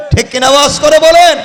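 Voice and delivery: loud, rising shout for a response; forceful preacher's call; calling to congregation. Real, not acted. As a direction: rouse the crowd to answer aloud. Preacher demands a louder response.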